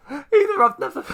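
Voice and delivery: words squeezed out mid-laugh, high and loud; laughing through words. Real, not acted. Gamer still laughing at the joke, laughing through words. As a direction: try to talk while still cracking up.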